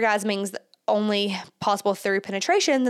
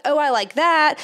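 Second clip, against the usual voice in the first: light, louder, pleased "Oh, I like that"; mild pleased approval; 5 semitones higher, 5 dB louder than usual. Pretend. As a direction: lift it with pleasure.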